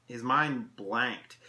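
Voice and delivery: ordinary delivery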